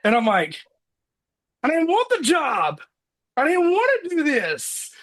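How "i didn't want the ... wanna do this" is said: high-pitched exasperated protest, half joking; retold protest. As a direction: squeak out a mock-desperate refusal. Retold protest at being handed job.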